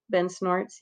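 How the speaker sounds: plain reading voice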